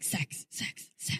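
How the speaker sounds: whispered, low and sultry; breathy whisper. Retold or imitated. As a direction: breathe it like a slow jam. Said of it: "it'd just be like".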